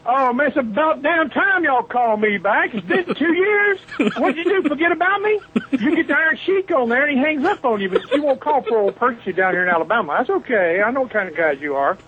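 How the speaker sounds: high-pitched teasing grievance, easing down at the end; mock indignation. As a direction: playfully put out at being forgotten. Mock complaint, then "No, seriously.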